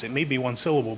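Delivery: ordinary talk, nothing marked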